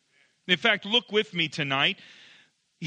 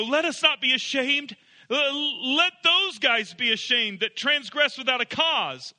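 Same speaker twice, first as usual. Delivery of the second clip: higher, begging tone; pleading; 6 semitones higher than usual. Shown as a demonstration. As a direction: plead it as a cry of desperation. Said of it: "What sounds as if he is saying".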